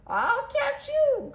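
very high, drawn-out call; squealed falsetto cry. A narrator's reading. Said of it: "cried Nellie".

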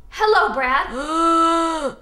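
slow groaning zombie hello; Hello, Brad stretched into a slow zombie groan; zombie greeting. Shown as a demonstration. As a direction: drag out a moaning hello. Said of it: "Say it".

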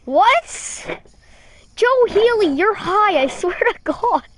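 high, loud disbelief; incredulous outburst. Real, not acted. Streamer calling out the viewer behind an absurd message, incredulous. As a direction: cry out as if you can't believe your ears.

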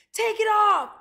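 put-on high, very loud boyish yell; yelled demand. A narrator's reading. Boys strip the rabbit on deck, excited shouting. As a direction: holler it out, high and drawn out.

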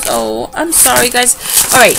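usual speaking voice

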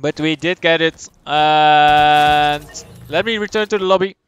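normal talking voice

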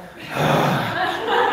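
big voiceless huff of resignation; exasperated sigh. Retold or imitated. Agreeable husband giving in, with an exasperated sigh. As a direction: let out one long heavy sigh.

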